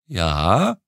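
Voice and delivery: light, cheerful sing-song Ja; cheerful fluting. Acted. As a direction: flute a bright questioning Ja. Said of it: "flötete aber ein fröhliches". (fröhlich geflötet)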